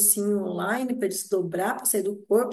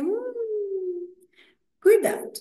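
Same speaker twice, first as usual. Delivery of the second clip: ironic warning; 9 semitones higher than usual. Real, not acted. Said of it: "ironic warning".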